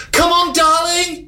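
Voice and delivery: high slow posh cheer; posh mother cheering from the touchline; posh mum voice. Retold or imitated. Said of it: "the posh voice".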